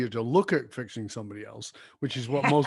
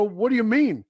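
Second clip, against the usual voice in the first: surprised question; 6 semitones higher, 6 dB louder than usual. Retold or imitated. Surprised reply to friend's remark.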